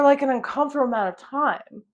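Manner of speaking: normal talking voice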